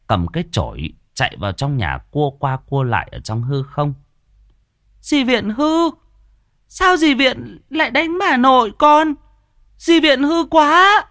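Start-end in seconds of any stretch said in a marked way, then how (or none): 5.0-11.0 put-on very high-pitched child voice; squeaky, indignant boy telling someone off; little boy scolding. A narrator's reading. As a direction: scold like a small child, getting louder. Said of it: "Thằng cu Nam ngừng mắng".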